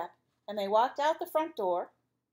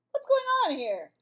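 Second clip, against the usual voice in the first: high, loud stern principal; principal demanding; 12 semitones higher, 6 dB louder than usual. Acted. Principal walking into the class, high and loud. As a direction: demand an answer.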